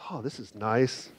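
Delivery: soft, impressed murmur over a shoe; quiet admiring murmur. Retold or imitated. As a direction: murmur it to yourself like you're holding a nice shoe. Shopper admiring shoes in store.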